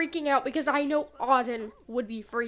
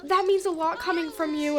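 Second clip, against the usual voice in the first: starstruck thanks; 5 semitones higher, 4 dB louder than usual. Retold or imitated. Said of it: "And she's like".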